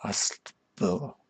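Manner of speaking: Ustbal softly as "ahst... buh", rising; halting pronunciation. Real, not acted. Streamer still stuck on a name, Ustbal as hesitant "ahst-buh". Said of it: "How am I supposed to pronounce that".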